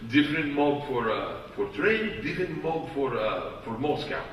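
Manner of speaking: put-on low, soft Russian-accented English; halting gangster explanation in a Russian accent; Igor. Russian gangster explaining privately why he can't travel, low accented voice. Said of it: "Igor pulls me aside".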